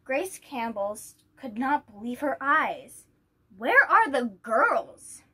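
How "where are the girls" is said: loud, slow, disbelieving cry; shocked outcry. Acted. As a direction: she can't believe her eyes, call it out. Grace shocked at the poster.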